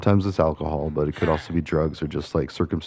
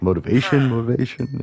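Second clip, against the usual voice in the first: higher-pitched, rising mock pep talk; Saul Goodman; 6 semitones higher than usual. Quoting a favourite show's character, lightly put-on higher voice. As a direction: imitate a TV character's pitched-up catchphrase. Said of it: "where Saul's like".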